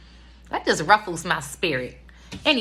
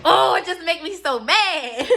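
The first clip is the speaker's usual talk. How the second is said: mock dad quote, laughing; 10 semitones higher than usual. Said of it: "When my dad be like".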